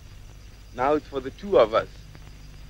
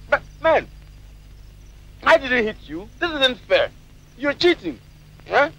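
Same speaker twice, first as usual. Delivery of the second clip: indignant protest; 9 semitones higher than usual. Acted. Trapped, protesting he was cheated.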